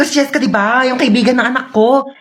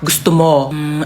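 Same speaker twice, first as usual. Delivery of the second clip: ordinary talk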